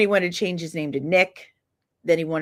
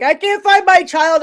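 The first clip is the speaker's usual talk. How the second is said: high, loud mock panic; imitating frantic mother; 11 semitones higher, 7 dB louder than usual. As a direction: cry out like a mother missing her child. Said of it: "when that woman is like".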